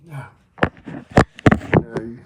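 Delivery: usual speaking voice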